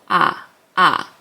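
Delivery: an open "ah, ah"; just the vowel, "ah"; silent at the start. Shown as a demonstration. Teacher contrasting start and end of a syllable. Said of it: "at the start of the syllable".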